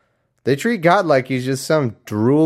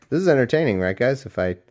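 ordinary talk

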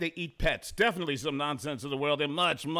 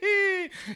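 high laugh; 4 dB louder than usual. Real, not acted. Host laughs.

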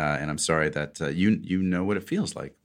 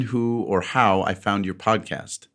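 ordinary delivery

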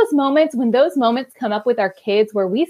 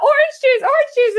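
put-on high, laughing toddler whine for juice; toddler begging; 5 dB louder than usual. Mom imitating her three-year-old begging for orange juice, high. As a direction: beg like a three-year-old, much higher. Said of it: "He's like".

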